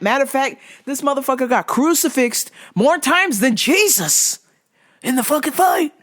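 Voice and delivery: high-pitched, indignant ranting; salty. Real, not acted. Bettor venting about the fighter losing, salty. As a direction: rant it high, exasperated at the fighter. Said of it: "I'm very salty".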